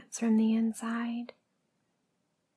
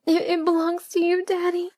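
much higher, strained voice; stammered submissive reply; 8 semitones higher, 4 dB louder than usual. Acted. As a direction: answer high and faltering on the first word. Acted answer during paddling.

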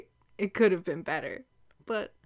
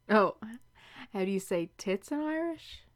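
normal talking voice

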